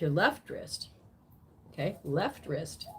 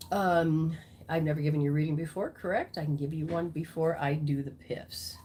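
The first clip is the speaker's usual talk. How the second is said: usual speaking voice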